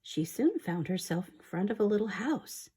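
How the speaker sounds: soft, hushed storytelling whisper; whispered story reading. Acted. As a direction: read the tale in a secret whisper.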